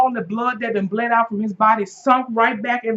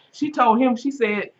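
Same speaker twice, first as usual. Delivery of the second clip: ordinary talk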